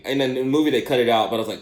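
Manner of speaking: normal talking voice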